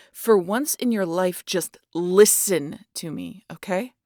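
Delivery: slow, loud and insistent; loud, drawn-out exasperated order; firm loud demand. Acted. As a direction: make him finally listen. Demanding hunter finally obey.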